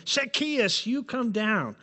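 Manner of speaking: high, sharp scolding call, a finger wagging; mock the stern old teacher scolding a child; hammed-up schoolteacher scolding. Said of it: "like an old school teacher scolding everyone".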